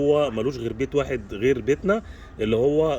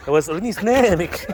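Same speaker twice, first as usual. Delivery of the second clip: much higher, sing-song coaxing of the dog; high coaxing pet voice; 7 semitones higher, 4 dB louder than usual. Real, not acted. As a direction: coax the dog up high and playful. Coaxing dog to show teeth.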